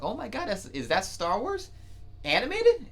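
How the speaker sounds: rising astonishment that peaks at the last word; amazed disbelief. Retold or imitated. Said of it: "And I was like".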